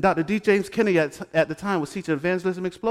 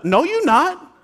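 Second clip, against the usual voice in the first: high, sharp mock scolding; church mother; 9 semitones higher, 4 dB louder, slower than usual. Church mother blocking a girl in a red dress, mock scold, high. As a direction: play the church mother shutting her down.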